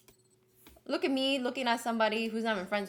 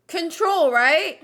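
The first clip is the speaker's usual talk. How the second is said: loud frustrated; 7 semitones higher, 12 dB louder, much slower than usual. Real, not acted. Frustrated guessing shortcut.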